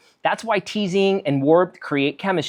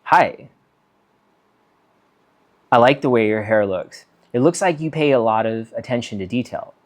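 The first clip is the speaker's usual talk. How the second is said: lower, easy, composed compliment; calm compliment; 5 semitones lower than usual. Shown as a demonstration. Complimenting a stranger's hair, calm and composed. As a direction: give it with nothing wanted back. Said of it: "being calm and not needing the number".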